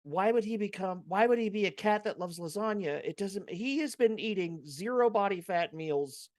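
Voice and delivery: worked-up, incredulous griping; indignant rant. Real, not acted. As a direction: protest in exasperated disbelief. Rant about actor cast as Garfield.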